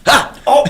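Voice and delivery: pirate laugh. Pretend. Ends pirate bit with laugh.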